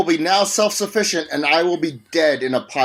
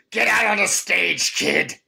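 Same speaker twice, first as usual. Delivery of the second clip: Disney villain; 5 semitones higher than usual. Retold or imitated. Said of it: "Why do you sound like a Disney villain".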